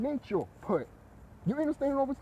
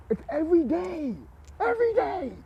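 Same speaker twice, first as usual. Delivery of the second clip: much higher, stretched exasperation; exasperated squeal; 11 semitones higher, 3 dB louder than usual. Real, not acted. Complaining about the teacher who hovers, high and exasperated. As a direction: let it climb in disbelief.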